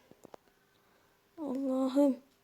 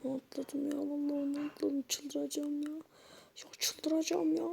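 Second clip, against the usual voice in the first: much softer, hushed fury kept under his breath; suppressed rage; 3 semitones higher, 13 dB quieter than usual. Real, not acted. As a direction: seethe quietly, almost whispering. Holding in rage while playing.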